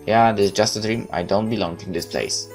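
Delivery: normal talking voice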